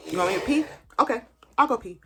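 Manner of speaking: fed up with the nurse, loud sarcastic okay; exasperated. Retold or imitated. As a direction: snap back sarcastically.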